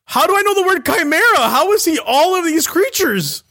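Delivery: hammed-up terror, voice climbing; mock panic. Pickle panicking at Baki's chimera forms, loud mock panic.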